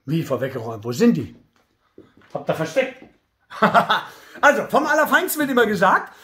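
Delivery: normal talking voice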